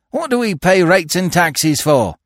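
loud, high-pitched indignation; indignant demand. A narrator's reading. Prisoner protests through cell grating, indignant. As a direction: demand it like an outraged citizen. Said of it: "indignantly demanded Billy through the grating".

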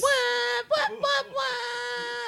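high hyped-up call; excited hype shout. Real, not acted. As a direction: hype the crowd back. Hyped answer to the crowd call.

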